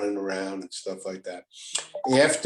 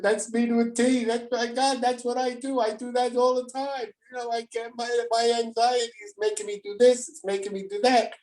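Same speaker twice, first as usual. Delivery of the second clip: much higher voice, a friend confessing with delight; excited old friend; 14 semitones higher than usual. Retold or imitated. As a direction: do the old patient's recognition in a high, altered voice. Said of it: "He said".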